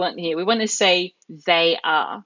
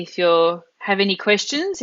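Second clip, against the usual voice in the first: ordinary talk, nothing marked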